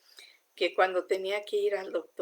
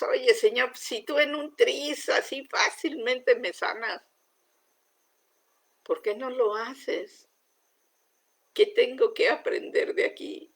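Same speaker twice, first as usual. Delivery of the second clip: higher, insistent questioning of God; pleading complaint; 5 semitones higher than usual. Retold or imitated. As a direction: press God for an answer. Retold complaining prayer to God. (reclamo insistente y agudo a Dios)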